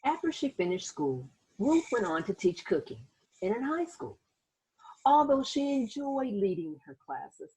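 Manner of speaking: put-on gentle read-aloud narration for children; teacher reading a picture book aloud; story narration. A narrator's reading. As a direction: tell it like a bedtime storybook.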